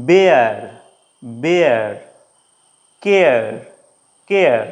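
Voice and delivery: "বেয়ার, কেয়ার" with এ gliding into আ, falling; ea diphthong demo. Shown as a demonstration. Teacher drills bear and care, gliding ea vowel. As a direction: model each word alone, এ melting into আ in বেয়ার and কেয়ার. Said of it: "এ উচ্চারণ হবে আর আ উচ্চারণ হবে".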